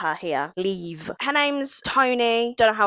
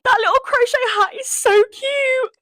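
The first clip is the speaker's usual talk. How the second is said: squealing gush; 5 dB louder than usual. Retold or imitated. Gushing quote, high squeal.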